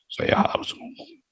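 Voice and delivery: a high breathless whisper; whispered vow. Retold or imitated. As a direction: whisper it like a secret resolve. Imitating his stubborn self. (berbisik tinggi dan pelan)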